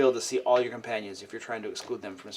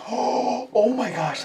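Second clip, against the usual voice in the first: surprised gasp; 9 semitones higher, much slower than usual. Real, not acted. Gasps at good play.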